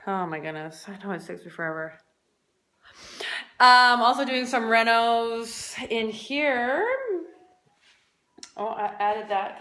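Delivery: ordinary delivery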